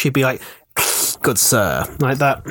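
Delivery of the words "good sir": put-on a lightly posh English accent on "Good sir"; posh English gentleman greeting, lightly done. The villain imagined greeting the players, light posh English accent. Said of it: "he'd be quite posh".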